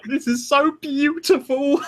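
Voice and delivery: high, slow, hammed-up sob-talk; exaggerated teary-eyed wail, drawn out; mock weepy gushing. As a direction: gush as if overcome with emotion. Acts own tearful reaction to show.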